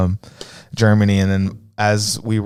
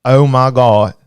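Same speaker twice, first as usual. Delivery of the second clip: mock Southern Georgia drawl, a bit louder; Southern Georgia accent; 2 semitones higher, 5 dB louder, slower than usual. Host guessing a viewer's home state, mock Georgia accent. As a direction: put on a Georgia twang for the exclamation. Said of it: "Southern Georgia?".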